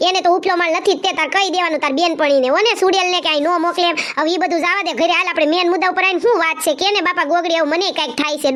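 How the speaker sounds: ordinary delivery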